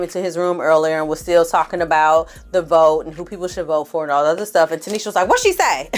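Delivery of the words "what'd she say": sharp high what'd-she-say; mock startled, much higher; quick surprised snap; surprised quote. Tanisha reacting to news.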